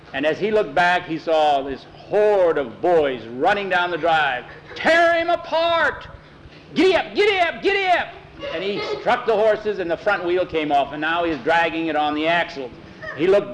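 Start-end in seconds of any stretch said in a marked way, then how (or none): 4.7-8.1 yelling in a terrified rush; frantic shout. Acted. Mister Waverly flees the charging boys, frantic shout.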